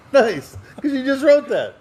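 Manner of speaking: high, laughing teasing reply. Real, not acted. Teasing his cohost about the fresh joke, laughing high. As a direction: tease him through a laugh.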